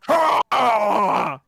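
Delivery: loud high beast roar; monster roar. Acted. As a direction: let out a big monster roar. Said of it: "it's gonna go".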